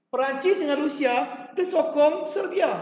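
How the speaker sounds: ordinary talk, nothing marked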